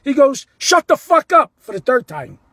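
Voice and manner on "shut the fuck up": high, loud retold shout; screaming, hollering. Player cursing at a fan for the third time, mock shout. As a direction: bellow it as the angry player. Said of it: "screaming, hollering".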